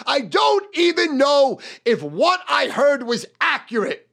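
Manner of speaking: usual speaking voice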